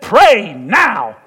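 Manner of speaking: loud, urgent one-word order; shouted command. Retold or imitated. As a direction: bark it over the storm. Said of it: "He said".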